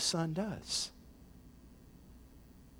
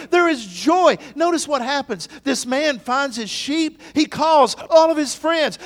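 loud, high-pitched preacher's proclamation; full-force preaching; 10 semitones higher, 15 dB louder than usual. Acted. Preacher telling the church of the found sheep, full-force preaching. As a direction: preach it out with big celebratory force.

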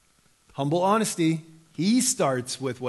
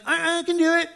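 put-on a much higher, louder child's voice insisting; daughter's protest; 5 dB louder than usual. Dad imitating daughter refusing help with the fork, high and insistent. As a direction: play the stubborn little girl. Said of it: "she just fight me".

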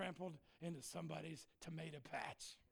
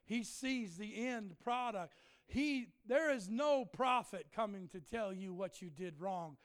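ordinary talk

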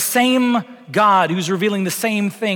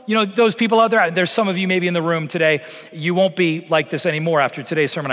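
ordinary talk

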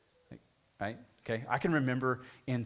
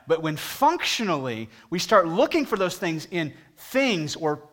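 higher and louder, driving the point home; preaching; 5 semitones higher, 8 dB louder than usual. Real, not acted. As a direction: preach it to the whole room. Preaching at full force.

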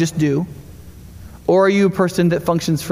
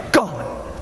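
whispered gone; 3 semitones higher, 6 dB quieter than usual. Retold or imitated. Turkeys vanish at a sound.

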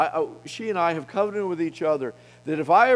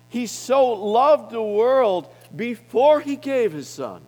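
higher, louder, slow on so loved; drawn-out emphasis before the drop on Son; emphatic stress; 7 semitones higher, 5 dB louder, slower than usual. Acted. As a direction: press the point home, slowly. Stressing love came first.

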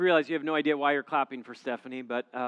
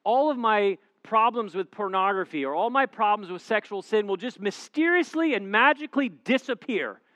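higher, louder mock hopeful single guy; mock naive guy; 6 semitones higher, 8 dB louder than usual. Pastor mocking single men who expect marriage to fix everything. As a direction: ham up the wishful thinking.

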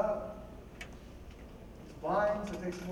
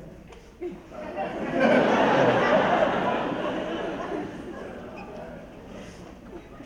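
burst of laughter; 6 semitones higher, 5 dB louder than usual. Real, not acted. Congregation reacts to joke.

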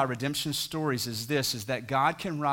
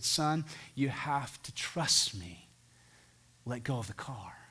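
slow, soft, whispered reassurance; loving father's whisper; 6 dB quieter, slower than usual. Retold or imitated. Father whispering under the door to his trapped son, tender. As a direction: hush him gently into trusting you. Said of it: "speak as only a loving father can do".

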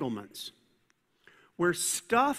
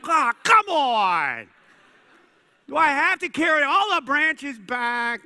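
loud, higher, slow groaning protest; whiny complaint; 7 semitones higher, 7 dB louder than usual. Retold or imitated. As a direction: whine it out like a sulky kid.

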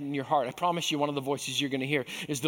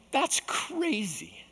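slow, drawn-out disbelief; high, slow that's crazy; incredulous; 7 semitones higher, much slower than usual. Real, not acted. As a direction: stretch it out, amazed. Preacher reacts to odd advice.

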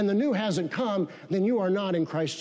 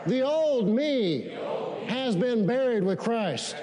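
slow preaching cadence; slower than usual. Acted. Preaching repeated declaration.

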